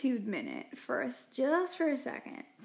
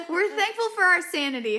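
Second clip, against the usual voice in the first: laughing; 6 semitones higher, 6 dB louder, faster than usual. Real, not acted. Laughing mid-sentence.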